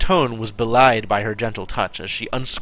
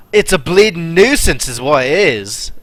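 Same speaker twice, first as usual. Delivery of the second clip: grumbling in a put-on Yorkshire accent; a louder Yorkshire accent, grumbling about it; Yorkshire grumble; 4 semitones higher, 6 dB louder than usual. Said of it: "grumbled in a thick Yorkshire accent".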